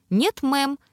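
put-on high, bright sugary little girl reply; sweet chirp. A narrator's reading. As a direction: chirp it sweetly like a cartoon princess. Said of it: "мило прощебетала Зи".

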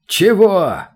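high, startled question; astonished. A narrator's reading. Players hear demon demand a journal, astonished. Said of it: "Три пары удивлённых глаз вытаращились".

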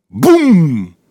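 boom. A narrator's reading. Explosion in the story.

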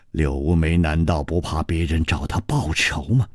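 low, icy and controlled; coldly. A narrator's reading. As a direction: lay out the lie in a cold low voice. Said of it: "尹基冷冷地说".

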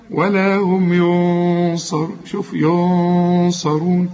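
ولا هم ينصرون drawn out, the nun hidden with nasal hum; slow recitation. Shown as a demonstration. Teacher recites the verse slowly for the student. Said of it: "ونخفيها".

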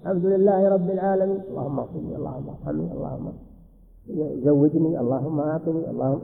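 quiet murmured dua between verses; mumbled prayer. Retold or imitated. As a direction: pray under your breath. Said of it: "أو قاعد يدعو".

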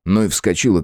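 usual speaking voice

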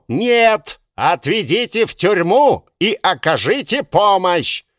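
pedantic correction. Acted. Second official correcting.

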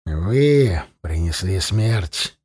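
low, slow accusation; grim interruption. A narrator's reading. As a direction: cut in darkly, drawing it out. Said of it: "перебил его Зарни".

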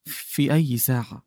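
hushed tearful question; faint voice. A narrator's reading. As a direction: ask it faintly, near tears. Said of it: "وسأل بصوت خافت".

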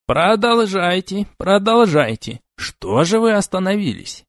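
high, slow gloating teacher voice; smug examiner. Acted. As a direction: needle him with fake kindness. Smug examiner taunts Oleg.

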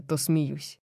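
ordinary delivery

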